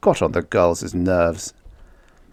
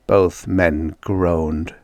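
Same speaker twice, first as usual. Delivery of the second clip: ordinary delivery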